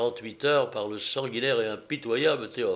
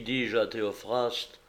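ordinary delivery, nothing marked

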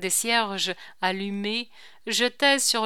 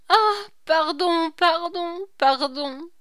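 high and loud, begging; pleading cry; 9 semitones higher, 7 dB louder than usual. A narrator's reading. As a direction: cry out for forgiveness on her knees. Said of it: "lui cria". (supplication criée, aiguë et forte)

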